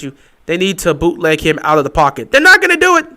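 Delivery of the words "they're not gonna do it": high, loud and fed up; exasperated shout. Real, not acted. Fan ranting at his team's coaches, exasperated. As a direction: throw it at the screen in frustration. Fan venting about play calling.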